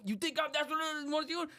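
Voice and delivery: high, taunting mock driver voice; angry driver. Imitating the angry Uber driver.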